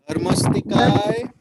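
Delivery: slow model for repeating. Shown as a demonstration. Teacher has Kevin repeat after her, slow rising model. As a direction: stretch out Dharmastikai, leaving it open for the echo. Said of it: "can you repeat it after me".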